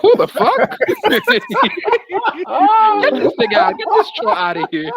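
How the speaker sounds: high, loud, cracked-up disbelief; incredulous, laughing order to throw someone out; laughing outrage. Real, not acted. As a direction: shout it through real laughter.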